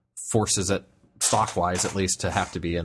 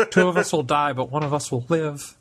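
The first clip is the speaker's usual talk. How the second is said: higher-pitched comic battle resolve; mock-heroic dog; 6 semitones higher than usual. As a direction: play a dog making a brave last stand. Dogs plotting heroic last stand.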